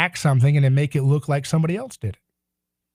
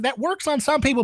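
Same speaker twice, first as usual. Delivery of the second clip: ordinary talk, nothing marked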